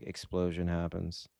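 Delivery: low, slow, dry mock caption read; deadpan narrator. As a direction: read it like a flat game caption. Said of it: "text that just says".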